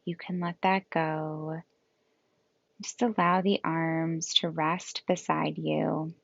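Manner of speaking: calm yoga nidra guidance with long, restful pauses; guided meditation. Acted. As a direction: gently lead them into rest, leaving space between phrases.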